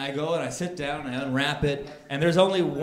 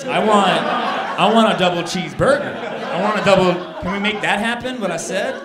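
getting louder, heated and incredulous; exasperated insistence; 6 dB louder than usual. Retold or imitated. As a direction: insist harder, voice rising in frustration. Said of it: "And I was like".

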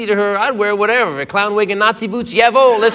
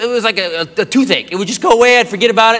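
ordinary delivery

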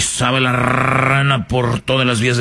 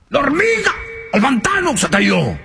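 dramatic rise; 12 semitones higher than usual. Acted. Dramatic storytelling.

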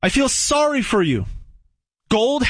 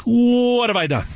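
mock regret; 3 semitones higher than usual. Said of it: "And you're like".

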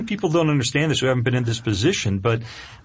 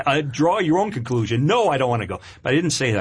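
ordinary talk, nothing marked

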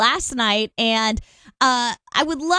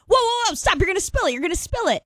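mock alarmed, higher-pitched warning; startled sleep-talker; 8 semitones higher, faster than usual. Wife imitating husband warning her in his sleep, mock startled. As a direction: imitate someone jolting up in bed to stop a spill. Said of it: "he sits up really fast and goes".

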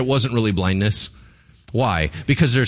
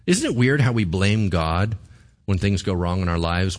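ordinary talk, nothing marked

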